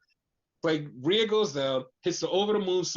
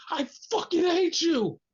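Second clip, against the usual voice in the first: furious yell; 11 semitones higher than usual. Retold or imitated. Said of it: "hearing her just say".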